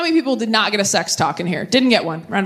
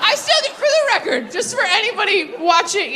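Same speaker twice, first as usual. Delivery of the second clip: much higher, chirpy clarification; bright reassurance; 8 semitones higher than usual. Shown as a demonstration. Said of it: "I don't know why I said it like that".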